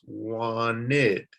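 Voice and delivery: "wanted" drawn out as WAN-ID; "wanted" with its ED as a separate "id"; two distinct syllables. Shown as a demonstration. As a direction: split "wanted" slowly into two beats. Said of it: "That's a two-syllable word".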